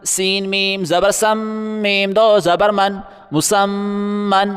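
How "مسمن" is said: slow drawn-out reading. Shown as a demonstration. Teacher slowly reading a word for the class. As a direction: stretch مسمن slowly, holding the doubled م.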